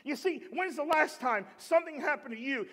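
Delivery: ordinary talk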